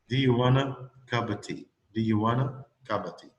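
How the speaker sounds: "Do you" reduced to "de", "cup of" run together as "kappa"; weak forms. Shown as a demonstration. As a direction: say "Do you wanna cup of tea" in relaxed connected speech. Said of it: "it will be de".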